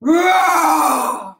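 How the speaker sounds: loud pretend lion roar. Imitates the lion toy roaring.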